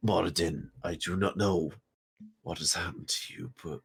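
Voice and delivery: low, measured in-character address to a god; solemn warrior in prayer. Acted. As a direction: speak gravely to your deity.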